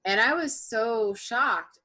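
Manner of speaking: ordinary talk, nothing marked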